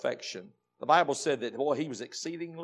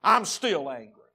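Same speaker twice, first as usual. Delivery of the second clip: loud, higher then dropping, stubborn; defiant Jonah; 3 semitones higher, 9 dB louder than usual. Acted. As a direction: hurl it at God then dig in. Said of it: "He said".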